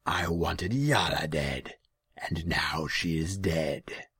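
very slow, loud, gloating demon; heavy, slow creature voice. A narrator's reading. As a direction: draw out the confession menacingly. Demon explains.

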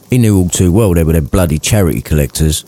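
ordinary delivery

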